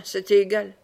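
ordinary delivery

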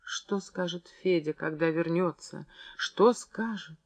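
soft, slowing, choked-back tears; tearful repeating. A narrator's reading. Клавдия без конца повторяла, глотая слёзы, tearful and soft. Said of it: "глотая слёзы, без конца повторяла".

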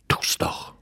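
venomous hissed whisper, quick and soft; hissed like a viper. A narrator's reading. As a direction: dart it out like a snake's tongue. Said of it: "zischte sie wie eine Viper". (giftig gezischt wie eine Viper)

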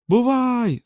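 high, drawn-out farewell call; spaceship's voice. A narrator's reading. Spaceship saying goodbye as it flies off, high drawn-out call. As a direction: sing it out high as the ship. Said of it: "сказав корабель".

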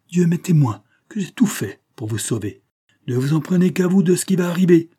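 high, loud warning in outrage; indignant, losing all restraint; uncontained anger. A narrator's reading. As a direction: build to a loud, high-pitched threat. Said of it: "hors d'état de se contenir".

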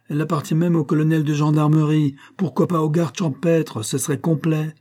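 mock the law in anger; teacher sarcastic against clerical control; bitter sarcasm. A narrator's reading. Angry teacher mocking the law.

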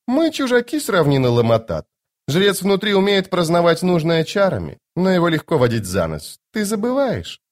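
high-pitched lady, dropping at phrase ends; playfully confident noblewoman, voice lifted high; self-assured sorceress. Acted. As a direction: speak as a lofty, teasing enchantress. Voices Lady Envy in dialogue.

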